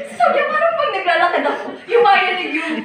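retelling the boss while laughing. Real, not acted. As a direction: giggle while quoting the boss. Laughing while quoting her boss.

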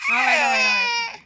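loud wailing cry; screaming cry. Real, not acted. Toddler crying.

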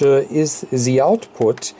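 ordinary talk

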